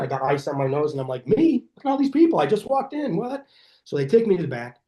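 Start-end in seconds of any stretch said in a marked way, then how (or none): 1.3-3.5 high, incredulous disbelief; much higher "who, me?" astonishment; mock surprise. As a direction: play the baffled patient skipped ahead. Surprised at being called first.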